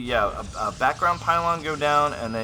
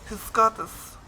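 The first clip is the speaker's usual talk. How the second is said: put-on higher, playful German accent; sexy German accent; 7 semitones higher, slower than usual. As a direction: do a mock-sultry German accent. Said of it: "my German accent is always kind of sexy".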